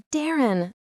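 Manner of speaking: a touch of anguish, pitched high; understated moan. A narrator's reading. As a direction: lightly moan the name. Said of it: "Zeke moaned".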